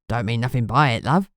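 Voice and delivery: low, broad Cockney; thick Cockney. A narrator's reading. Ghoul informant placating the annoyed detective, thick Cockney. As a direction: brush it off in deep Cockney. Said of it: "The Cockney accent came out thick and broad".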